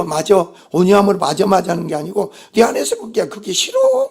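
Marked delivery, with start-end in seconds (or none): 3.3-4.1 high-pitched inner protest; acted refusal. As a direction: balk in a high, resisting voice. Voices inner resistance to the word.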